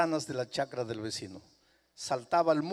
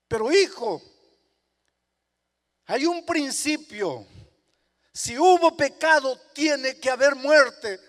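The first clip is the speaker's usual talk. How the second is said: loud, high, solemn voice of the Lord; preached voice of Jesus, loud and grave; Jesus speaking firmly; 10 semitones higher, 11 dB louder than usual. Acted. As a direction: hold the principle over the begging child.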